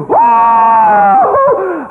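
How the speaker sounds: high, loud imitated child's cry; hammed-up child cry, high and loud; mock child wail. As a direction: yelp like a little girl hit with a belt. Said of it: "She goes".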